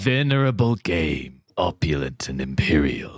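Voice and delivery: low, haggard voice with British twang; spooky voice. Acted. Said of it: "putting a little bit of a British twang in there".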